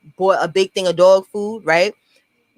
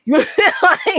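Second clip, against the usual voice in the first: real laugh over the words; 9 semitones higher than usual. Real, not acted. Laugh heard.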